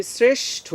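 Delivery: শ্রেষ্ঠ with a dental s first, then a sh before ঠ; clear pronunciation demo. Shown as a demonstration. Teacher models শ্রেষ্ঠ for the class, careful articulation. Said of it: "প্রথমে দন্ত স, পরে তালব্য স".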